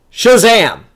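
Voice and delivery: sudden loud, high acted shout; shouted magic word. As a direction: belt it out as one explosive cry. Acts out Billy finally saying it.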